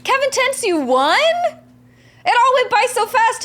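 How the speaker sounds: high, loud, startled disbelief; surprised. Real, not acted. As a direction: caught off guard, voice jumping up. Learns the Battle Royale ended.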